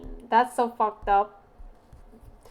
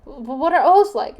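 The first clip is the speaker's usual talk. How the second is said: louder, higher baffled asking; incredulous question; 4 semitones higher, 8 dB louder than usual. Retold or imitated. Retelling her own confused reply to the friend, loudly baffled. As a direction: demand an explanation in disbelief. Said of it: "I was like".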